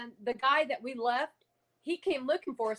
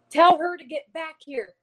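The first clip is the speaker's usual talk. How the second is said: retold spirit yelling; 5 semitones higher, 7 dB louder than usual. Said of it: "he's sitting there yelling at me".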